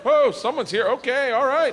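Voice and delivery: high, loud startled gasp of words; nervous high surprise; startled whoa. Retold or imitated. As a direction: play it shocked someone just walked in. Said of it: "the look where everyone looks like".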